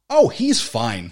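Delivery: slow sarcastic dismissal. Retold or imitated. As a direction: drawl it mockingly. Mocking TV2 logic.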